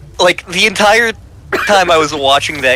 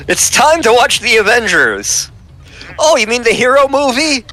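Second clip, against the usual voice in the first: mock clueless voice; 7 semitones higher than usual. Mock dialogue of confusion.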